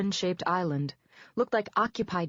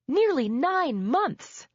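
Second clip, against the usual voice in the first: slow, high, louder stressed words; bitter emphasis; 9 semitones higher, 5 dB louder, much slower than usual. Acted. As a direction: drag it out in disbelief. Wife confronting husband.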